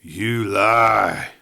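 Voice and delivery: barely voiced, breathy accusation; breathed. A narrator's reading. Dying outlaw breathing an accusation at the doubter. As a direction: breathe it out with the last strength. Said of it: "he breathed".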